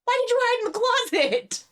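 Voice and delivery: high startled yelp. Acted. Josh startled to find Willa hiding in his closet, yelping. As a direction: jump at the shock of finding her.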